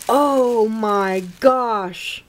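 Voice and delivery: very slow, drawn-out astonishment; stunned amazement. Real, not acted. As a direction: stretch each word out in awe. Stunned by a big gift.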